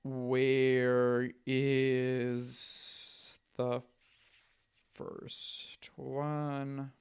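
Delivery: very slow, soft thinking aloud; absent-minded searching. Real, not acted. Podcaster hunting for the first comic run, absent-minded searching. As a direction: stretch each word while looking something up.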